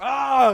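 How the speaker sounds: loud, high mock attack cry; imitated rage yell. Third grader attacking the backing-up kids, imitated rage yell. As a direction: lunge in with a yell. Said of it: "I remember him yelling too".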